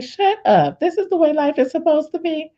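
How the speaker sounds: high-pitched amazed disbelief; mock-stunned realisation; retold confusion. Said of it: "I'm so confused".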